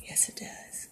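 soft whispered reply; whispering. Real, not acted. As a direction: whisper it back. Unvoiced aside.

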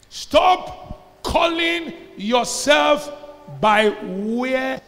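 loud, drawn-out preacher's cadence; fiery preaching. Acted. Pastor exhorting the congregation, loud and slow. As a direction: lean on every phrase to stir the church.

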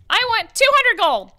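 high, loud squeaky little lizard-dog merchant; kobold voice. Acted. Kobold stallkeeper calling out to customers, high and squeaky. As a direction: yap it out like a tiny eager kobold shopkeeper. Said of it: "he's like".

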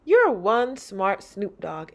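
put-on slow, loud kid's voice; Angel's voice. Angel praising the dog, slow and playful. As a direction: tease the dog like a cool kid. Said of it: "Angel says".

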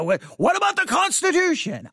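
high, mocking voice of an angry critic; mock outraged critic. Mocking critics of the pastor in a high voice. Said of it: "because they're gonna".

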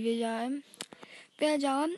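usual speaking voice

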